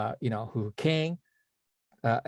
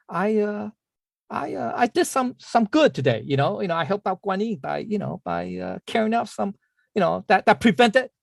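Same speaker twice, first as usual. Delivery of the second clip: higher-pitched, halting self-congratulation; pleased with himself; 7 semitones higher than usual. Acted. Old monk congratulating himself on doing good, mildly self-satisfied. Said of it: "was pretty happy, you know, with himself".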